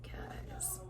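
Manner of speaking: breathy whispered aside. Real, not acted. Whispering guys to her viewers about the wine.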